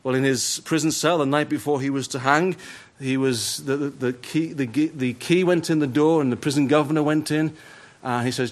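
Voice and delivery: usual speaking voice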